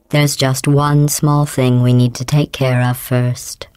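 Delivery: ordinary delivery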